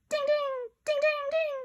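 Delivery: high bell-like dings; chiming dings. Acted. Kids imitating the sale notification, high chimes. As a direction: ring out like a chime.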